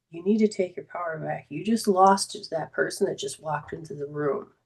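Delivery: quietly quoting the instructor's counsel; retold discreet advice. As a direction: keep it low and discreet, as if retelling his words. Karate instructor speaks discreetly to her.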